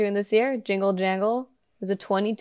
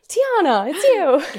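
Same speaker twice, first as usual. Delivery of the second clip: high, loud delighted outburst; excited recognition; 9 semitones higher, 5 dB louder, much slower than usual. Retold or imitated. Fan retelling hearing Tiana's voice, excited recognition. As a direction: gush as if meeting an idol. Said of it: "I was like".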